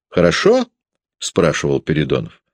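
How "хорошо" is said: Хорошо? asked much higher with a rising tune; pressing question. A narrator's reading. Peredonov badgering Sasha for an answer, high and rising. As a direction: push the boy for an answer, pitched up.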